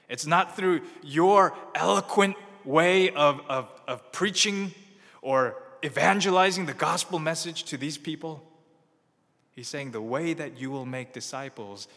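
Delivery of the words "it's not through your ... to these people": rising emphatic preaching. Real, not acted. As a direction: preach it harder line by line. Preacher building to a peak.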